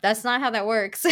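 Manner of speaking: normal talking voice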